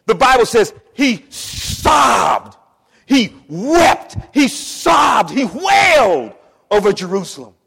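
high, shouted preacher's cry; full-force preaching. Acted. Preacher crying out how Jesus sobbed over Jerusalem, high loud shout. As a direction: hammer each verb out loudly.

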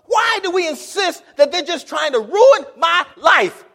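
high, shouted sermon climax; impassioned pulpit shout, hammering each phrase; full-force preaching. Real, not acted. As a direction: cry it out to the congregation, loud and high.

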